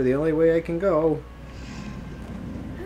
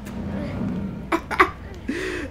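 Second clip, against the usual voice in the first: long, high breathy laugh; high-pitched wheezing giggle; giddy laughter; 12 semitones higher, 6 dB quieter than usual. Real, not acted. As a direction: laugh helplessly with thrill. Thrilled laughter.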